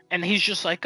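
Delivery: ordinary talk, nothing marked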